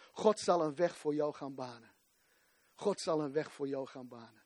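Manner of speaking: tender quiet reassurance; soft assurance. Real, not acted. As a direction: drop to an intimate hush. Closing promise softly repeated.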